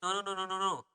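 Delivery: acting out a quick, quiet brush-off; repeated polite refusal. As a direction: rattle off the refusals lightly under your voice. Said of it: "So I'm just saying".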